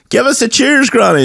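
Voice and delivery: high, bright party toast call; cheery party relative. Retold or imitated. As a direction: chirp at granny to raise a glass. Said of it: "and they were like".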